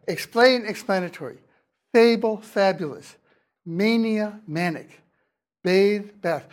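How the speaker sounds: word pairs contrasting ay in "Fable" with short a in "fabulous"; vowel shift pairs. Shown as a demonstration. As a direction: set "Bathe" against "bath" as clear example pairs. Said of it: "changing to eh".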